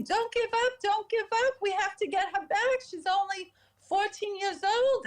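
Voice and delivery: high-pitched retold plea, mock-screamed without the volume; frantic, crying dentist. Dentist urging the hygienist on during CPR, retold as frantic high cries. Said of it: "the dentist was screaming, crying".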